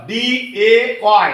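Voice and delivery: ডিএওয়াই spelled out letter by letter, very slowly; slow spelling. Shown as a demonstration. Teacher spells the word for pupils to repeat. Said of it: "ডে উচ্চারণ দুই ধরনের".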